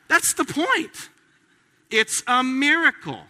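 preacher rising to the climax; emphatic preaching. Real, not acted. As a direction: hammer the point home. Preacher driving home the point.

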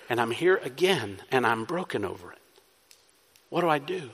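soft, subdued voice of a penitent; broken, weighed down. Acted. Sinner confessing to a merciful God, softly broken. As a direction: sound broken by your own failing, quietly. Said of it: "breaking down".

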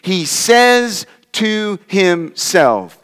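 slow, spaced stress on "He says to himself"; emphasized phrase. Shown as a demonstration. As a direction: weigh out each word of "He says to himself". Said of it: "Notice the phrase in the E S V".

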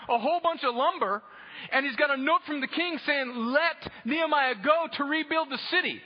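loud, high, driving sermon delivery; full-force preaching. Acted. Preacher exposing what Nehemiah's opponents knew, full-force preaching. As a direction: hammer out the story to the congregation.

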